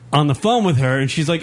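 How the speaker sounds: ordinary talk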